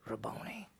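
very soft, low murmur; barely voiced, low quote; hushed retold reply. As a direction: drop to almost nothing for the answer. Retold reply on recognizing him.

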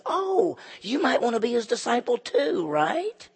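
high-pitched, teasing mock surprise. Healed beggar making fun of the religious leaders, mocking. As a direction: swoop up high on the "oh" and needle them. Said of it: "he's making fun of them".

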